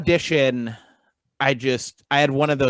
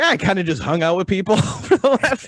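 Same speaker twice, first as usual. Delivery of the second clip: sheepish laughing answer; 9 semitones higher, 6 dB louder than usual. Retold or imitated. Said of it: "I'm like".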